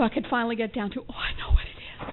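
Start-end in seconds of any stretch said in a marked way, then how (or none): 1.1-2.1 breathy whispered realization. Retold or imitated. Finally tells her sponsor her fear, whispering. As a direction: whisper it as if it just dawned on you.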